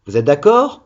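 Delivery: normal talking voice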